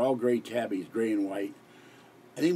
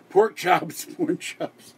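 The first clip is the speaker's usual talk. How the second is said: giggling at the slip; 6 semitones higher, 6 dB louder, slower than usual. Real, not acted. Giddy over his own slip.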